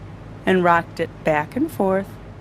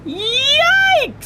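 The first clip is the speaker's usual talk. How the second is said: very high, startled teacher's shriek; comic high-pitched alarm; alarmed yelp; 3 dB louder than usual. A narrator's reading. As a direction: yelp in sudden shock. Teacher startled by hidden baby.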